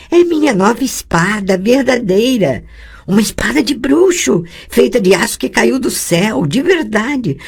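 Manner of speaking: put-on a girl showing off her new witcher sword; proud young girl. Girl tells her tutor proudly about the sword, eager young voice.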